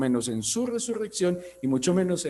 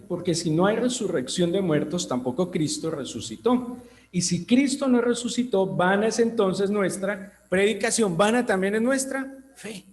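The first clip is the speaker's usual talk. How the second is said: fervent scripture reading; 4 semitones higher than usual. Acted. Preacher reads scripture with fervor.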